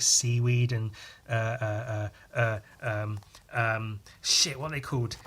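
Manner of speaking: ordinary talk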